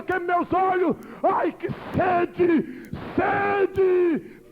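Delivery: high, shouted panic, seeing demons; terrified dying woman. Retold or imitated. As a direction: scream in terror as the flames take her. Dying woman sees demons, burning.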